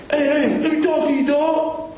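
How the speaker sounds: pitched well up, imitating a buddy waving him over; lightly mock his buddy's casual hail; mimicked friend calling out. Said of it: "The motherfucker say".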